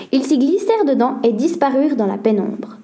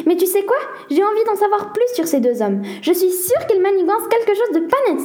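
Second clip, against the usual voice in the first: higher-pitched, lightly excited exclamation; exclaiming; 7 semitones higher than usual. A narrator's reading. Nina telling her brother she suspects two men, exclaiming. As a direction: announce a suspicion with mild eagerness. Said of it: "s'exclama Nina".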